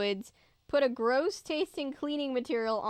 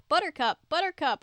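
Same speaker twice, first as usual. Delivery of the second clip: higher, louder call for the lost dog; 6 semitones higher, 6 dB louder, faster than usual. A narrator's reading. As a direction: call the dog's name across the woods.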